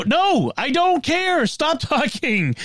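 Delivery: high, drawn-out exasperated plea; exasperated mock scolding. Fed-up owner telling Alexa to stop chattering, exasperated. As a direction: cut the gadget off, pitched high, dragging every word.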